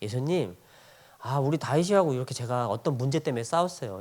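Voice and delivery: subdued, humble acted prayer; prayer to Jesus. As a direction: quietly confide a quarrel to Jesus. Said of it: "밤기도 시간에 가가지고 우리 예수님한테 물어보는".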